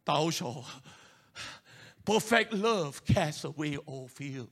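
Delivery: low, slow solemn scripture intoning; lightly voiced old Bible pronouncement; King James voice. Preacher mimicking the inner voice in King James English. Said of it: "sounds a little bit King James Version".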